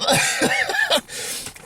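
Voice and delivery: high breathless giggle; real helpless laughter; laughing. Real, not acted. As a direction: burst out laughing at your own story. Laughs at his own punchline.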